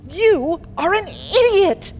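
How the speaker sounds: high, loud angry yell; angry shout. Acted. Queen yells at her inquisitor. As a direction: scold him furiously.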